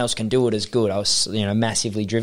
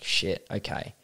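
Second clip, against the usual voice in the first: quiet realisation; 3 semitones lower, 9 dB quieter, slower than usual. Retold or imitated.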